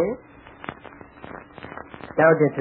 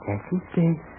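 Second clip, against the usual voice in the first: hypnotic voice; 8 dB quieter than usual. Acted. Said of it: "she's hypnotizing the other gal".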